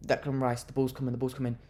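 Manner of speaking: ordinary delivery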